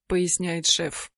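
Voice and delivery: plain reading voice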